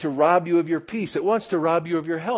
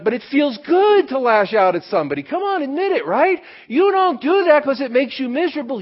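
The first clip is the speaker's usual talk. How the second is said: loud and higher, pressing them to admit it; rising pulpit challenge, louder and higher; urgent preaching; 9 semitones higher, 6 dB louder than usual. Real, not acted. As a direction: push hard, come on, admit it. Preacher urging congregation to admit.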